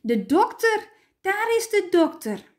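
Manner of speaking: high, loud excited cry; child calling out. A narrator's reading. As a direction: call out like a thrilled child. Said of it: "een van de stampertjes roepen".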